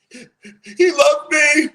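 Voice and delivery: high, loud, drawn-out wail; imitating a bawling girl; mock bawling. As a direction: wail it through hammed-up sobs. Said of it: "starts, like, talking like that".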